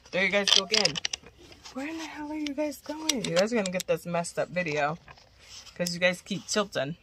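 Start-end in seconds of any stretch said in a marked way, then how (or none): 1.7-3.2 exasperated squeal. Real, not acted. Tripod keeps tilting.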